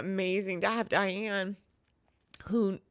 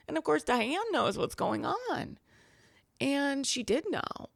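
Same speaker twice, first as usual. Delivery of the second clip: normal talking voice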